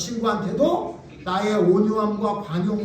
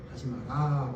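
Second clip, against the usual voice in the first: much softer, lower, gentle; soft mild telling; 9 semitones lower, 8 dB quieter, slower than usual. Shown as a demonstration. Pastor to congregation, the weak way to forbid: soft mild. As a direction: say it meekly, with no force. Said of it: "이렇게 말해야 안 하지".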